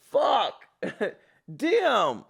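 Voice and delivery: usual speaking voice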